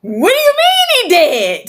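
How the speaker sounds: high, loud scream of disbelief; disbelieving shout. Retold or imitated. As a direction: yell it at the surgeon in shock. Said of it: "I tried to choke the surgeon out 'cause I was like".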